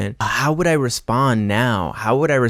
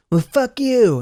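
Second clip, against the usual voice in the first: much higher, snippy mock comeback; mock rude retort; 13 semitones higher, 3 dB louder, slower than usual. Someone with a shitty attitude, snippy mock retort. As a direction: toss it off like a rude stranger. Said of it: "someone who's like".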